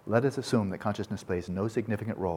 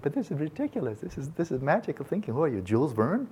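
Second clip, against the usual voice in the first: higher, scoffing nineteenth-century sceptic; mocking sceptics; 6 semitones higher than usual. Nineteenth-century scientists dismissing relativity, higher mocking scoff. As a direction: scoff as if it is absurd. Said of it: "they said".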